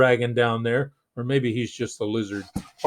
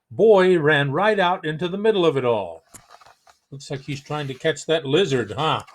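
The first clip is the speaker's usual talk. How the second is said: ordinary delivery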